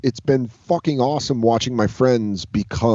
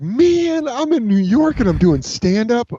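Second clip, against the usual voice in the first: much higher, giddy inner voice; giddy disbelief; 12 semitones higher, 3 dB louder, slower than usual. Retold or imitated. Young comic in New York, reliving his giddy disbelief. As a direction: squeal it like you can't believe you made it. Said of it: "my first holy shit moment".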